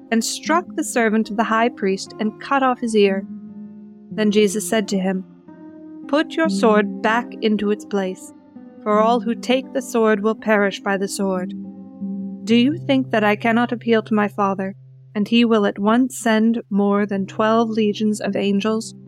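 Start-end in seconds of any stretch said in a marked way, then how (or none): none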